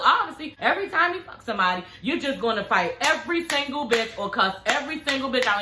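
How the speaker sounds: ordinary delivery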